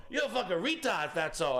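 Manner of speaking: high, indignant imitated mobster; mock angry insult. Mobster insulting the salesman, mock indignation. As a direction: throw the insult back, high and heated.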